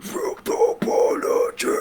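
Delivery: unvoiced, drawn-out scream-whisper; whisper yelling. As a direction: death metal whisper scream. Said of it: "it's clearly a whisper scream".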